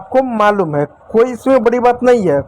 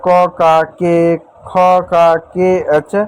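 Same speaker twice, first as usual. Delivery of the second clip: slow spelling out; 3 semitones lower, much slower than usual. Shown as a demonstration. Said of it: "जिसमें आप पढ़ते हो ना".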